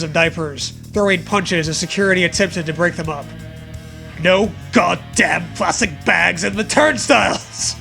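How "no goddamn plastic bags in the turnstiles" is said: usual speaking voice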